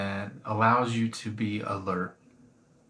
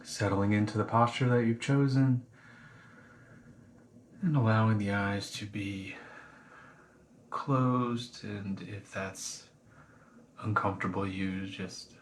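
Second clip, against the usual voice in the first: guided meditation. Acted. Teacher guiding listeners through a body scan, calm meditation voice. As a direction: ease them gently into the body scan.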